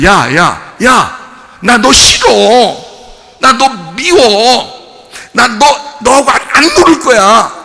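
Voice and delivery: yelling at sorrow to go away, high and loud; loud childish brush-off; shouted rejection. Retold or imitated. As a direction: shout it off like a child refusing to play. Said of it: "이랬단 얘기예요".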